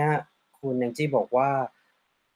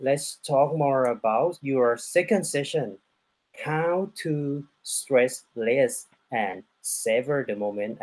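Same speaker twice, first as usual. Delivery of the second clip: very slow, deliberate question to the guest; slow careful English; much slower than usual. Real, not acted. Host asking the guest about her second session, slow and deliberate. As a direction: spell each word out slowly for a non-Thai guest.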